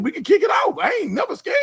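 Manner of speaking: high shouted boast; excited shout. Real, not acted. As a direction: yell it out hyped.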